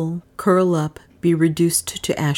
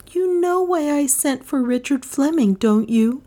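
higher, pleading question; beseeching; 10 semitones higher than usual. A narrator's reading. Dale pleading with her lover, higher and beseeching. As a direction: beg him to understand. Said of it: "her eyes fixed beseechingly".

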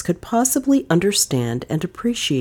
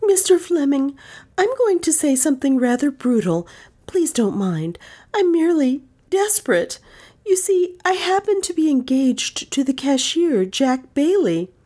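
put-on high, urgent young woman; desperate rush; 11 semitones higher than usual. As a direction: blurt it out desperately. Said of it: "the words came in a rush".

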